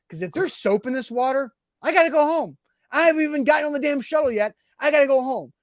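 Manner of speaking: high-pitched mock panic; retold fretting. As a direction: act out a worked-up complaint. Retold panic about soapy water.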